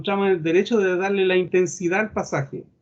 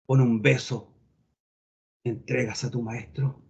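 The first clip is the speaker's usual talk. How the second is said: low, stern reproach, dropping softer; halting low delivery, hard without volume; hard retold reproach; 5 semitones lower, 4 dB quieter than usual. Preacher voices Christ confronting Judas, low and hard. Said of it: "Duro, ¿se entiende?".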